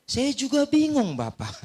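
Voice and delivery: ordinary talk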